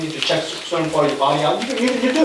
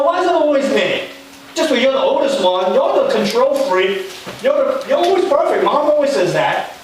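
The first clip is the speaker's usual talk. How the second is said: whiny sibling complaint; 7 semitones higher than usual. Acted. Preacher voicing Martha griping to Jesus, high and whiny. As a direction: complain like the put-upon older sister.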